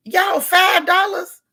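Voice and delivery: high, slow, loud outrage at the price; outraged disbelief. Real, not acted. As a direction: stretch out five dollars in shock.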